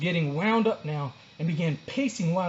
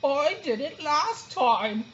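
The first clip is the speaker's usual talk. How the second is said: higher, slow yawned line; yawning sloth; 9 semitones higher, much slower than usual. A narrator's reading. Second sloth refusing the door, yawning. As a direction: yawn the words out. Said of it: "yawned another".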